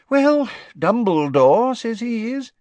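high, fussy official voice; a busy minister brushing it off; Fudge's voice. Acted. Fudge tells the Prime Minister about Dumbledore in a high fussy voice.

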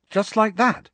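high, wondering gasp of disbelief; amazed, barely believing it; awestruck. A narrator's reading. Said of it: "looking awestruck".

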